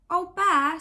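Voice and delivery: high, loud little-bear call; a cub calling out eagerly; small bear calling across the room. A narrator's reading. Little Bear calling, voiced character.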